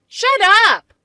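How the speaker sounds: high, loud snap of shut up; angry shout. Acted. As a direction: shout her down. Sisters' quarrel, shouted.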